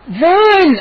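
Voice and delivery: high yelled Run; scream. Acted. Boy screaming at his friend to run. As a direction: shout it in a panic as you flee. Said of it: "I screamed".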